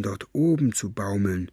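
usual speaking voice

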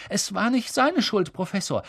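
high, earnest plea; pleading girl. Acted. Parvati pleading with McGonagall, high. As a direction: defend him to the teacher.

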